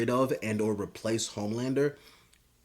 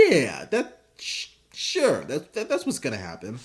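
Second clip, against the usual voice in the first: mock hesitant agreement. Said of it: "is like".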